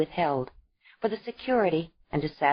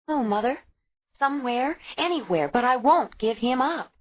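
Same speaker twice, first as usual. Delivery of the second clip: higher, insistent and proud; defiant young mother; 7 semitones higher, 3 dB louder than usual. A narrator's reading. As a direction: fight for the child. Ayla refusing Isa.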